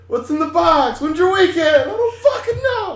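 high frantic yell, rising to a laughing shout; Brad Pitt shout. Retold or imitated. Quoting Brad Pitt's box scene, high frantic yelling. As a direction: scream it like Brad Pitt in the movie. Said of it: "Brad Pitt".

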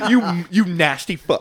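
high, slow mock outrage; mock disgust. Mock disgust at ghost joke.

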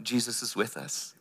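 a hushed, certain promise; quiet reassurance. Acted. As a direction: pastor calming the congregation after the storm. Reassuring after storm imagery.

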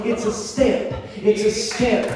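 normal talking voice

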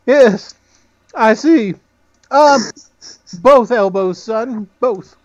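high, half-laughing gnome; squeaky gnome barely holding back a laugh. Acted. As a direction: gnome answering the player, high and amused. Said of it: "He looks over at Jenna".

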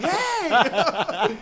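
loud high mock scold of hey; mom's scolding shout. Mom answers her cursing daughter, loud mock scold. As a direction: snap back like a shocked mom. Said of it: "the mom's like".

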